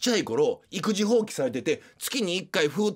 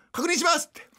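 very high staff phrase; shrill service voice; 10 semitones higher, faster than usual. Acted. Mother at register confirms order, high shrill service voice. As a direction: pipe it up high for a customer. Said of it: "すごい甲高い声で".

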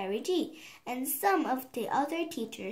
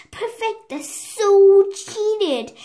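much higher, much louder and slow; raging outburst; 8 semitones higher, 13 dB louder, slower than usual. A narrator's reading. Alice storms down the corridor, furious that Perfecta cheated, raging shout. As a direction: spit it out in a fury. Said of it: "exploding with rage".

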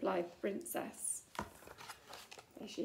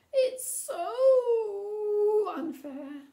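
much higher, louder, very drawn-out cry; wailing protest; 9 semitones higher, 13 dB louder, much slower than usual. Acted. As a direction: stamp your foot and wail it out. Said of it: "stomped the princess".

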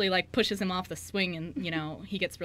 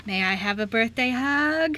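put-on louder, slowed, childlike plea; little sister asking her brother for a hug; eager little girl; 5 semitones higher, 10 dB louder, slower than usual. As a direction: sweetly beg for a birthday hug. Jessie asks Ace for hug.

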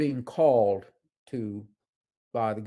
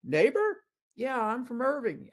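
lightly voiced puzzled congressman, higher; a hint of a surprised politician; congressman replying; 12 semitones higher than usual. Congressman turns to the tourist, mildly puzzled, higher. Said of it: "And he stops and goes".

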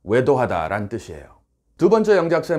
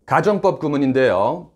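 ordinary talk

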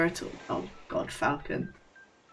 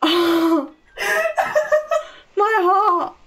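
higher, louder gasping after a scare; shaken; 9 semitones higher, 4 dB louder than usual. Real, not acted. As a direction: catch your breath, heart pounding. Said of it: "I'm almost crying".

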